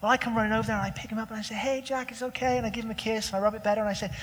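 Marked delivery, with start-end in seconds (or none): 1.5-2.6 put-on soft, reassuring hush to a little boy; soothing toddler. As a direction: comfort a crying child gently. Father comforting crying toddler.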